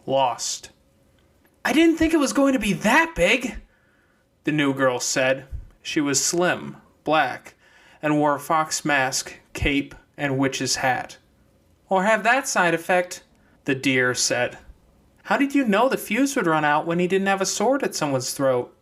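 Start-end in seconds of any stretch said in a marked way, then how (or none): 1.6-3.6 put-on high, light girl's voice; new girl's voice. New girl speaks, voiced figure. 11.9-13.2 put-on high young girl's voice; deer girl's voice. Deer girl speaks. 15.2-18.8 put-on high young girl's voice; deer girl's voice. Deer girl questions fox.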